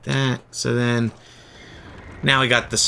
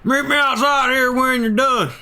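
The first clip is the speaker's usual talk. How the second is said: higher gang boss voice; Dutch voice; 8 semitones higher than usual. Acted. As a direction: play the commanding gang leader. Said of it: "I mean, I like my Dutch voice too".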